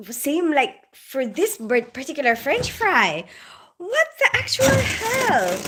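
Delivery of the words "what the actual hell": high, slow what-the-hell outrage; disbelief. Retold or imitated. Replaying her baffling French fry dream.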